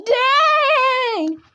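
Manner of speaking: long, loud, high-pitched cry of awe; drawn-out astonished yell; amazed shout. Acted. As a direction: holler it like a crowd blown away. Onlookers react to the hammer.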